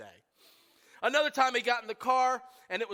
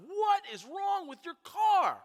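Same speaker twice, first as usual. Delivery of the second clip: dad freaking out; 10 semitones higher, slower than usual. Retold or imitated. Dad yells at son about the shaking car, slow alarmed shout. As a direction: bellow it like a panicked father. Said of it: "And he freaks out".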